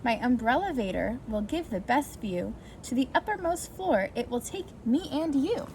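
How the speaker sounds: plain reading voice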